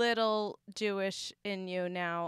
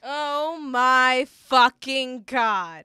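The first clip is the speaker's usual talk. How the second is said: shocked; 2 semitones higher, 8 dB louder, much slower than usual. Real, not acted. Reacting to the story.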